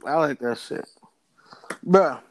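ordinary talk

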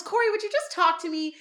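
high-pitched exasperated plea, not very loud; mock yell. Topanga to Corey as he turns to leave, mild mock yell. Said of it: "and she yells".

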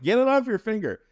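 high, loud mom voice, brushing it off; imitating mom. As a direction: squeal it out, dismissive and high. Mom voiced in hospital story.

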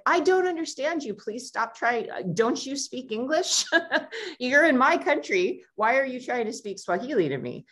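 high, exasperated mock outburst, pitched well up; annoyed tourist. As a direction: play the irritated local who can't understand a foreigner, half laughing. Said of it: "you'd get kind of pissed and you'd be like".

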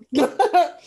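short high burst of laughter; bright laugh. Real, not acted. Laughing over cookie remark.

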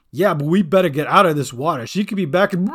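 loud, fast goblin warning; urgent warning. A narrator's reading. Bink warns Gran to leave water, loud urgency.

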